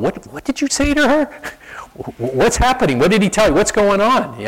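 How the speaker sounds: high, breathless amazement with a laugh; astonished angels. Pretend. Curious angels crowd Gabriel after his visit, high astonished questions.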